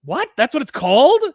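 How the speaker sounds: stunned disbelief. Real, not acted. Learns the comic's title.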